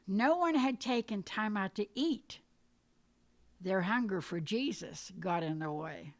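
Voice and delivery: usual speaking voice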